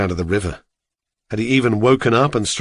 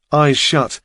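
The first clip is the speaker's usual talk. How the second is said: ordinary delivery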